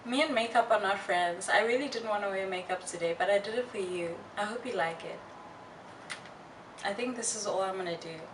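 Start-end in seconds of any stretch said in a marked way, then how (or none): none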